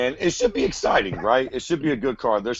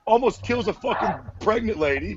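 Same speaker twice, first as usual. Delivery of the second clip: mock outraged fan; 8 semitones higher than usual. Voicing fans reacting to DC.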